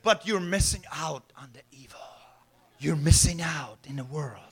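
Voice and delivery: low, seductive whisper; tempting whisper. Acted. Temptation luring Eve toward evil, in a low whisper. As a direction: whisper the lure in their ear.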